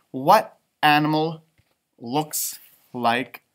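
plain reading voice

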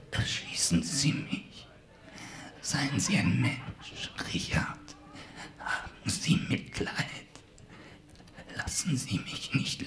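faint, slow whispered begging; weak pleading dying man. Acted. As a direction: plead with your last breath. Poisoned villain begs to be shot.

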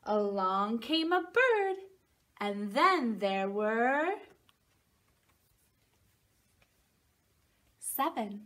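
slow, sing-song picture book reading; reading to a toddler. Acted. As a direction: read it like bedtime for a little one. Reads picture book to children.

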